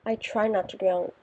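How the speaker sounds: usual speaking voice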